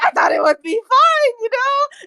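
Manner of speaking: high-pitched pleading defence; sheepish excuse. Acted. Hyden excusing himself while being shaken, high sheepish pleading. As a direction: plead your case in a high voice.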